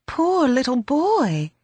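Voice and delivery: high, drawn-out cry of sympathy; a young girl's slow, pitying exclamation; tearful pity. A narrator's reading. Belinda pitying an orphaned boy, tearful pity. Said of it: "Belinda cried, wide eyes filling with tears".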